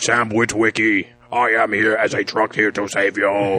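stiff machine delivery, word by word; robot voice. Pretend. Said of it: "you're doing the robot voice?".

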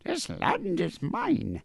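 very high, slow mock Irish brogue; squeaky-high, hammed-up bad Irish accent; terrible Irish accent. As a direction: stretch it out in a ridiculous Irish accent. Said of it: "The worst Irish accent you've ever heard".

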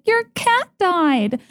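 high, singsong mock announcement, drawn out slowly; tactless sorority girl. Sorority girl announcing Stephanie's dead cat to the chapter, high mock singsong. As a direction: imitate a girl cheerfully broadcasting bad news. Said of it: "the tone in which she was like".

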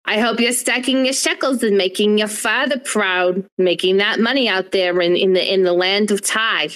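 hammed-up New York mother, proud and fussing.

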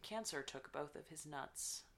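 quiet, deadpan narration; imitating a straight-faced audiobook reader. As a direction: read it softly with no reaction. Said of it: "she was reading this deadpan".

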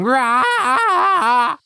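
loud, high mock monster roar; monster scream. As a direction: yell it like a tree bursting in. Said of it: "while screaming".

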